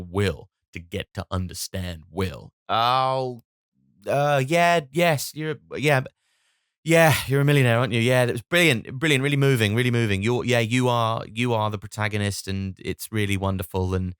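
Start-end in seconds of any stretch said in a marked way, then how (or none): 2.7-13.9 high, loud fawning praise; gushing flattery. Retold or imitated. Family fawning over Will's reading, loud and gushing. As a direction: fawn over the millionaire.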